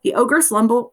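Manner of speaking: ordinary delivery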